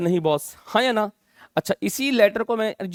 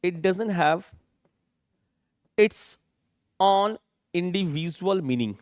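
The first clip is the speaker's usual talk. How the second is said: 1 slow dictation; much slower than usual. Shown as a demonstration. Teacher dictating definition slowly.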